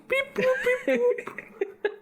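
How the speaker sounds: high, laughing beep-boop robot. Pretend. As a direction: chirp it like a toy robot. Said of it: "that robot voice".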